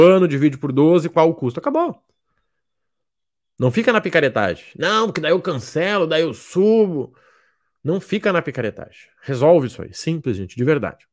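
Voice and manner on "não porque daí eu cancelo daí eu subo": mock student excuse. Student justifying to Marrone, lightly mimicked. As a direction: act out the student's lame excuse.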